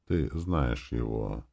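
low, slow, hushed statement; very quiet. A narrator's reading. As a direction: say it softly and slowly, dropping the pitch. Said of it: "сказала очень тихо, не поднимая глаз". (очень тихо, низко и медленно)

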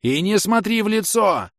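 loud high-pitched warning; urgent shout. A narrator's reading. Inquisitor warning the old woman off the witch, shouting. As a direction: cry it out high and slow. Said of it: "закричал я".

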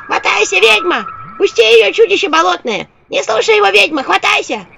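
high, loud squeaky devils; cartoon imp voice. A narrator's reading. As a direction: yell like tiny cartoon devils. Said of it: "противный голосок ... из мультфильма".

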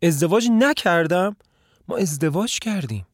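the floor drops out from under her; shocked disbelief. A narrator's reading. The wife told she was never married, crying out in shock.

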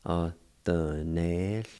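Atenesti spoken slowly, each syllable held; slow demo pronunciation. Shown as a demonstration. Teacher shows students the HL sound, slowly. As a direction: stretch out Atenesti for learners. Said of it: "you pronounce the H L sound with the N E like this".

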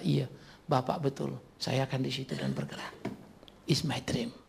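quiet, fading to a whisper; soft earnest reply. Retold or imitated. Inspired follower softly answering the pastor, quiet. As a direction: hush it like a confession.

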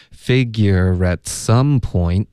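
slow "Figure at some point", linked, final t dropped; slow linking demo. Shown as a demonstration. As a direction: model "Figure at some point" slowly for learners. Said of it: "point结尾的特我们要省略".

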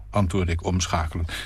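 ordinary delivery

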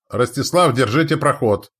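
loud battle command; shouted order. A narrator's reading. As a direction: bark the order.

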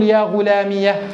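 يا غلامية said slowly, with a final ha sounded on pause; slow yā ghulāmiyah, stopping on a breathy ha; pausal ha. Shown as a demonstration. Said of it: "فتقول".

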